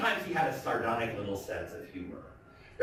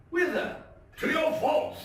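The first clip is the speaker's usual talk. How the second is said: gleeful Fortunato. Acted. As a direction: crow it like a happy drunk.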